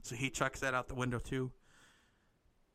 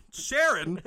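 surprised call; 5 semitones higher, 11 dB louder than usual. Acted. Surprised recognition in improv story.

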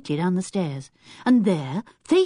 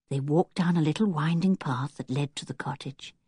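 plain reading voice

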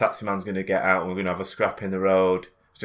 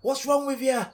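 put-on high Irish old lady scolding, pitched well up; 13 semitones higher than usual. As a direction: nag like an angry little Irish granny. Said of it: "Irish accent".

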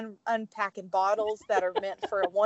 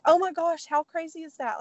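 high-pitched retold excitement; marveling, voice lifted high; amazed; 8 semitones higher than usual. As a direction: act out happy amazement. Retelling amazement at baby being nearby.

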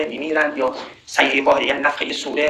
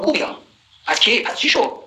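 cheeky mock dare, rising much higher; imitating insolent defiance; 5 semitones higher than usual. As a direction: play the brazen challenger. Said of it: "این دیگه خیلی جسوره".

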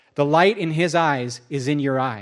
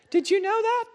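much higher, wide-eyed; amazed. Retold or imitated. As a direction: squeak it out in wonder. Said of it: "he's like".

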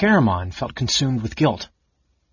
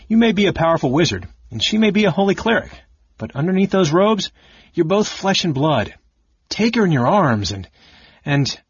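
rising fast and eager; carried away; 7 semitones higher, 4 dB louder than usual. Acted. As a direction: get swept up in the passion. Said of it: "so carried away".

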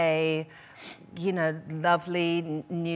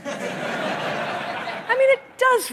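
laughing; 4 dB louder, much slower than usual. Real, not acted. Laughing at the pub joke.

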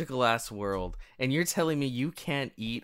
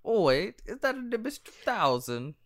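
high, awed British schoolkid voices; mock British kids; 10 semitones higher than usual. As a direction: put on an excited, high-pitched British accent. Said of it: "They're like".

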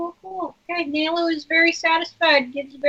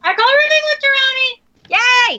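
excited; 10 semitones higher, 10 dB louder than usual. Acted. Players eagerly claim roommates.

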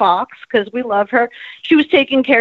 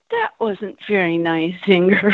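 gentle scolding; 5 semitones lower than usual. Retold or imitated. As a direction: imitate a friend's understated reproach. Friend mildly scolds her after spitting.

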